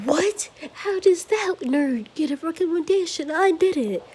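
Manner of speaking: jealous rival protesting the teacher; outraged disbelief. Acted. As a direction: squeal it like the news is unfair. Bakugo outraged at recommendation.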